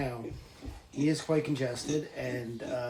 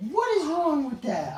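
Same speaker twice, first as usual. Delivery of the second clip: much higher startled exclaim; surprised; 13 semitones higher, 3 dB louder than usual. Real, not acted. Startled by what the pig is doing, squeaking high. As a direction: blurt it out in surprise.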